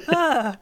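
high, startled little yelp; a high mock gasp of fright. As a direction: imitate a startled man jumping at a slammed door. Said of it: "And he goes".

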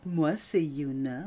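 slow, sugary honeyed voice. Pretend. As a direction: drip honey on every word. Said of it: "J'adore la voix mielleuse".

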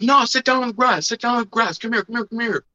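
mock bossy kid. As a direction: imitate a little kid bossing him around. Another kid ordering, imitated.

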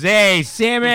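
wife calling back over the phone. Retold or imitated. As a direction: shout back the nickname. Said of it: "and she goes".

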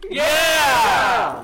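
loud, high cheering yell; shout. Real, not acted. Cheering answer to the host.

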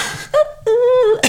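a real squeal of laughter at herself; high squealing laugh. Real, not acted. Laughing at her own age mix-up, squealing. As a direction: let a high laugh burst out.

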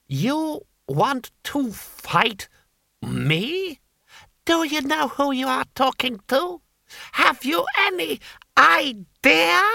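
high, slow, rising outrage; incredulous Lord Hong. A narrator's reading. Lord Hong to Two Flower who challenges him, rising outrage. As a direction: build from disbelief to indignation.

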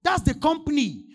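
slow, full-voiced preaching shout; preacher roaring slowly, pulling the words apart. Real, not acted. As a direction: hammer it home to the congregation. Preacher shouting the key point.

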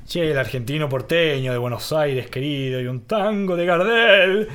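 slow, drawn-out porteño Argentine; Argentine accent. Shown as a demonstration. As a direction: croon it like a Buenos Aires tanguero. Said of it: "Eso sí me sale".

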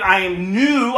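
ordinary delivery

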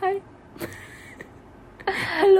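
high-pitched silly hello; squeaky high voice. Pretend. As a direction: squeak it out like a tiny mouse. Greeting in a silly voice.

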